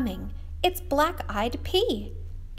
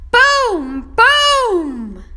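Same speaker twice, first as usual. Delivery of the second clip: higher, much louder boom boom; booming refrain; 10 semitones higher, 14 dB louder than usual. Acted. Teacher booming the refrain for the kids. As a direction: let the booms explode.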